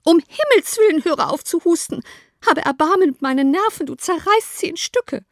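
high, loud, overwrought plea; exasperated outcry. A narrator's reading. Mother begging Kitty to stop coughing, exasperated outcry. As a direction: cry out as if your nerves are shredded. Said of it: "wandte sie sich zu Kitty und rief".

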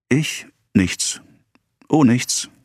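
a touch of flustered hesitation; mild stammer. A narrator's reading. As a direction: falter lightly between the words. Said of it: "stammelte Emma".

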